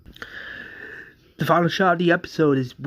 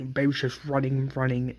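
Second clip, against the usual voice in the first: ordinary delivery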